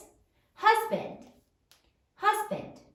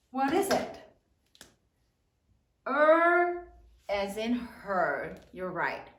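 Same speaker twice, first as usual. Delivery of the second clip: ordinary talk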